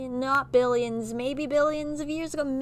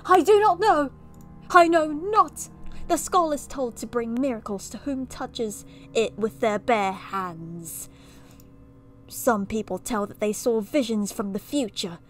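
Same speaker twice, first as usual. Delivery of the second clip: hammy British storyteller with theatrical swells; overdone bad British accent; mock British accent. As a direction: read and comment as a pompous fake Brit. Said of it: "I'm just gonna do it anyway".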